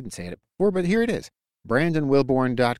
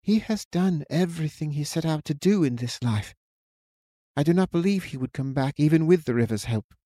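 soft but firm woman; 5 semitones higher than usual. A narrator's reading. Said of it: "Louise said softly but firmly".

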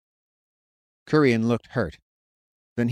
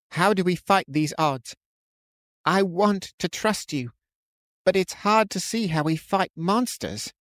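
put-on high, anxious young man voice; frightened young man; 9 semitones higher than usual. A narrator's reading. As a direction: plead with a worried friend.

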